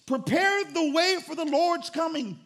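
high, loud, slow proclamation; preaching the verse. Shown as a demonstration. Pastor proclaiming John's words to the church, loud preaching. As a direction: proclaim it like John crying out. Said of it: "I would really preach it".

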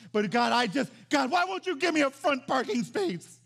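loud, high-pitched mock whine; mock pleading complaint. Preacher playing a churchgoer begging for front parking, loud mock whine. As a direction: plead with God like a put-out churchgoer.

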